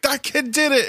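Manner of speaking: high, thrilled voice; excited Saul. Retold or imitated. King thrilled when David beat Goliath, high and excited. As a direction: cheer it up high like a proud king.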